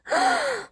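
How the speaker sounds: sharp startled gasp; a high gasp of shock. A narrator's reading. Elder cats gasp at the news, sharp startled gasp. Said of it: "The older cats gasped".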